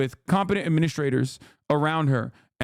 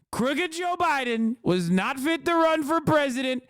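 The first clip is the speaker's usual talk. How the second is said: Donald Trump impression; 9 semitones higher than usual. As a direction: read the statement as a hammed-up Trump. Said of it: "Let's see what he had to say".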